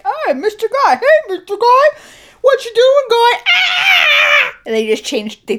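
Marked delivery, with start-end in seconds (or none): 0.0-3.4 high shouted skit character; silly skit voice. Retold or imitated. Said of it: "We're like". 3.5-4.6 skit scream. Retold or imitated.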